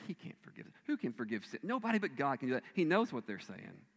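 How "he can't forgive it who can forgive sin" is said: whispered thoughts. Retold or imitated. People's inner thoughts voiced.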